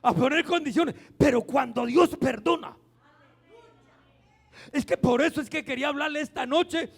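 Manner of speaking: fiery pulpit preaching; preaching at full force. Acted. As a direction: hammer the point home to the congregation. Preaching on God's pardon.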